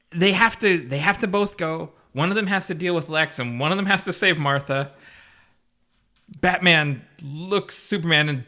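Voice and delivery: normal talking voice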